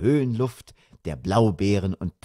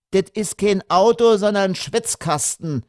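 high Berlin-dialect grumble; loud start, pitched up like an annoyed girl; complaining daughter; 11 semitones higher, 4 dB louder than usual. A narrator's reading. Daughter complaining about the hot car, grumbling.

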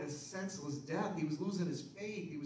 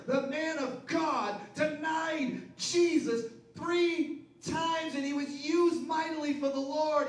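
high, loud, slow preaching; fiery preacher climax; preaching at full force; 10 semitones higher, 5 dB louder than usual. Acted. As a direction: lift the crowd with it.

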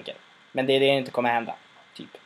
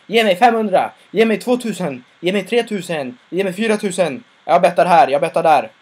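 higher, louder calls of bidders outbidding each other; eager bidding shouts; 5 semitones higher, 5 dB louder than usual. Retold or imitated. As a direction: shout the bids over the crowd. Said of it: "alla börjar stå och betta om".

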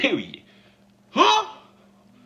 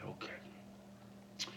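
breathy whispered okay; mock hushed okay; 27 dB quieter than usual. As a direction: drop to a bare whisper.

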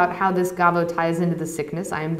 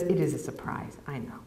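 Marek's shrugging reply, much softer; quiet admission; 6 semitones lower, 11 dB quieter than usual. Acted. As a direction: let it drop off almost to nothing.

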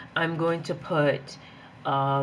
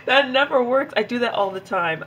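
higher and louder, laughing it off; yelling; 9 semitones higher, 6 dB louder than usual. Real, not acted. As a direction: yell at yourself with a laugh. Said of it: "I am yelling too".

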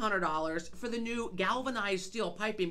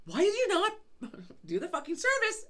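exasperated why; indignant demand; 9 semitones higher than usual. Retold or imitated. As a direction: demand an answer, incredulous. Confronting the dryer guy.

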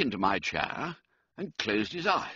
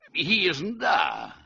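slow puzzled report; 5 semitones higher, 4 dB louder, slower than usual. A narrator's reading. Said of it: "I said".

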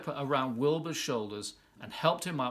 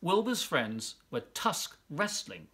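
plain reading voice

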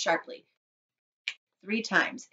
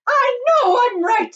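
high, loud, drawn-out shriek; hysterical retort; 13 dB louder, slower than usual. Acted. Wife retorts to agreeing husband, hysterical shriek. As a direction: shriek back at him. Distraught mother snaps at husband.

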